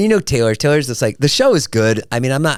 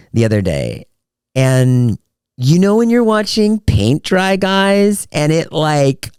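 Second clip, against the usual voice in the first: gushing, high and loud, drawn out; 5 semitones higher, 4 dB louder, slower than usual. Retold or imitated. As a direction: ham up the breathless storyteller. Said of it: "She was like".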